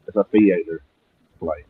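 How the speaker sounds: usual speaking voice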